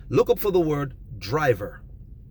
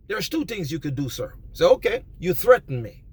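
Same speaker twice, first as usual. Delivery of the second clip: usual speaking voice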